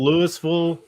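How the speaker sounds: Louisville said as LOO-uhs-vul, with an S; alternate Louisville pronunciation. Shown as a demonstration. Showing another way to say the city's name, Louisville as LOO-uhs-vul. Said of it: "There's, like, five different ways to say it".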